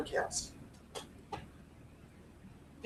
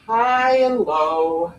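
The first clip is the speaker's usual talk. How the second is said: slow; much slower than usual. Shown as a demonstration. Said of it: "Use your voice".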